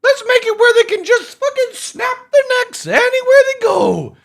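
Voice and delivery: high, loud, laughing silly voice; mocking developers. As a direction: ham up the clueless developers. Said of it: "C I G are like".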